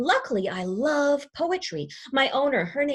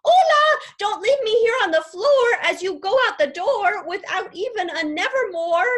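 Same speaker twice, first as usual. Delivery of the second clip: much higher, louder squawking plea; 11 semitones higher, 7 dB louder than usual. Acted. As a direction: screech it after them as they leave. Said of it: "and I squawk".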